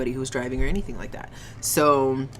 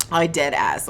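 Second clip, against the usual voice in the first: usual speaking voice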